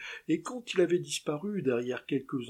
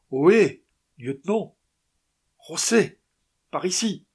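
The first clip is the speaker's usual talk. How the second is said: loud, high call across distance; 4 semitones higher, 10 dB louder than usual. Acted. Martinez calling José across the rocks, loud high shout. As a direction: shout to be found.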